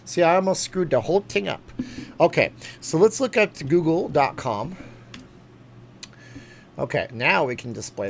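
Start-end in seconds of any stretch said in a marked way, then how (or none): 2.2-4.8 louder host read; announcer voice. Acted.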